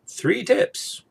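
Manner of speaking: peppy host voice going up at the end; upbeat announcement. Shown as a demonstration. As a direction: announce it like a cheery presenter. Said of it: "Or if you're in Europe".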